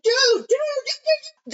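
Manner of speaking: loud, very high repeated protest; imitating frantic disbelief; mock alarm. Friend protesting a second killing, mock alarm.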